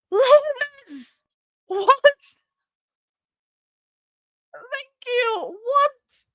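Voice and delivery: gasp, then very high squealed disbelief; stunned delight. Real, not acted. Streamer stunned by a viewer's gift, amazed. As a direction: react high-pitched, overwhelmed by surprise.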